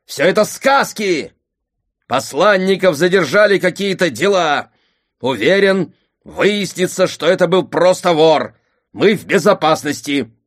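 loud, high angry denial; shouting. A narrator's reading. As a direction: keep yelling, insisting. Father keeps shouting denial.

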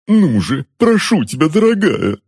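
high-pitched begging to his wife; wheedling, imploring husband; pleading. A narrator's reading. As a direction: beg her sweetly, pitched well up. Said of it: "взмолился дядюшка".

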